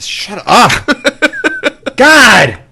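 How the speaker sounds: high, loud laughing outburst; laughing shout. Real, not acted. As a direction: yell through laughter. Yells at teasing partner.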